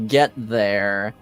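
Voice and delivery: usual speaking voice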